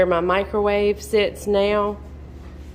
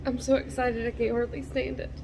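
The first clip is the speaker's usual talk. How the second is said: soft, raised voice, choked with excitement; emotional, overexcited; 4 semitones higher, 7 dB quieter than usual. Real, not acted. Telling viewers how thrilled she is, softly emotional. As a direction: barely hold it together, quietly overwhelmed. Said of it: "I'm getting really emotional".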